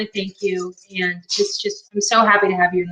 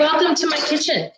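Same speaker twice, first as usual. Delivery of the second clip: lightly hammed-up show-host welcome, pitched up; cooking show host; 6 semitones higher than usual. Host greeting her stream guest in a mock show-host voice. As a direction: sell it like a TV cook opening the show.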